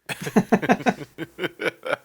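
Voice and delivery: high, chuckling laugh; laughter. Real, not acted. Co-player amused by the potted plant joke, laughing. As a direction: burst into a run of high giggles.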